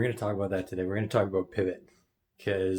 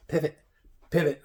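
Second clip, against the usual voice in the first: higher, punchy little mock calls; playful call-out; 6 semitones higher, 6 dB louder than usual. Podcaster having fun with basketball pivots, playful call-outs. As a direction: toss the word out for fun, punched. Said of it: "I'm just having fun with it".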